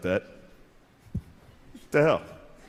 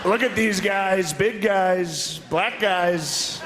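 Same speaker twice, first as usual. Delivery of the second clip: higher, slow mock Trump voice; Trump impression; 8 semitones higher, much slower than usual. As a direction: lay it on as a clueless Trump. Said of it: "It's like".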